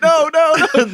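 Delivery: loud, high-pitched retold cry of protest; hammed-up panicked "no"; mock horrified shout. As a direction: act out someone yelling in alarm. Reenacts horror as building is blown up.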